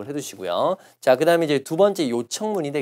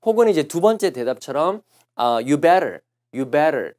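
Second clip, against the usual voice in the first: usual speaking voice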